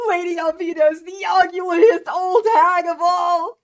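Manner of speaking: loud, high defiant yell; defiant shout. Acted. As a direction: shout it out at last with courage. Koby defiantly yells at Alvida.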